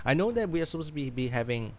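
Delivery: ordinary delivery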